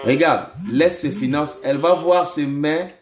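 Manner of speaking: firm, emphatic command; deliverance preaching. Real, not acted. Prophet orders a spirit out of the caller, commanding preaching.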